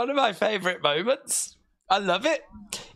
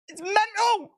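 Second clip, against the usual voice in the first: much higher, squeaky delight; gleeful; 10 semitones higher than usual. Real, not acted. Repeating in glee.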